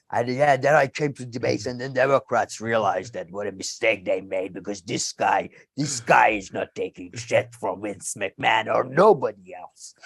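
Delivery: Minnesota accent pitched well down, imitating Ventura; deep Minnesotan accent. Retelling Jesse Ventura's debate story in a low Minnesota accent. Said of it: "that deep Minnesotan accent".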